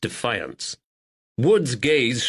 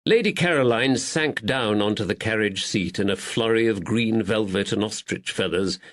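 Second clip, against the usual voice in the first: ordinary delivery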